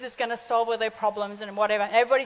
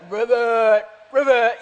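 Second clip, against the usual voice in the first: frog croak; 2 semitones lower, 6 dB louder, slower than usual. Retold or imitated. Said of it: "he just go".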